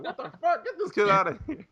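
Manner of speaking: high, laughing disbelief; incredulous officer. Retold or imitated. Officer reacting to Dave.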